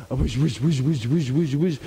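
rhythmic swishing repeats; imitated paddling. Storyteller mimicking the dog's doggy paddle, rhythmic swishing. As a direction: pulse each whoosh like a paddle stroke.

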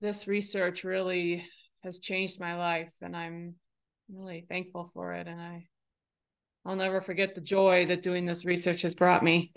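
soft, lifeless telling of good news; joyless delivery. Shown as a demonstration. Teacher shows a dull delivery that doesn't match the words, soft and lifeless. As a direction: say the happy words with no joy at all. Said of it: "You can't say".

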